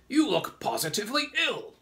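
high, loud grasshopper voice; old grasshopper character. A narrator's reading. Old green grasshopper remarks on James, high exclamation. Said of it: "the old green grasshopper asked".

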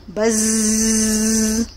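long, droning buzz like a bee; drawn-out buzzing sound. Shown as a demonstration. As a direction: hum it out like a bee in flight. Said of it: "try buzzing like a bee".

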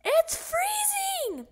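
high, slow squealing shout. A narrator's reading. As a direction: yell it into the stethoscope. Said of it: "shouted into it".

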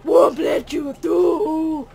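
slow shouted mock Russian accent. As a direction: bark it in a hammy Russian accent. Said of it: "Imagine if you have a Russian accent".

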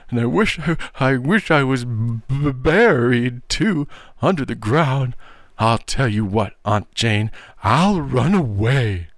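high boy's wail, stammering through mock sobs; howling. A narrator's reading. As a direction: drag it out like a blubbering child. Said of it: "he howled".

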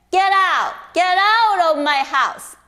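father roaring at his son; furious shout. Acted. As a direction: throw him out in fury. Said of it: "his father was so angry".

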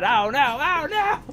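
panicked rising oh no, oh no; terrified gibbering. Real, not acted. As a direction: cry out as the horde appears. Enemies swarm in game.

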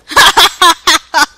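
big loud laugh; loud burst of laughter. Real, not acted. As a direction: laugh out hard at your own joke. Laughing at own joke.

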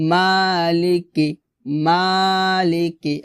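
মালিকি with মা held, লি and কি short; recited মালিকি, short last two syllables; long MA only. Shown as a demonstration. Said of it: "মাতে এক লিফ টান হবে".

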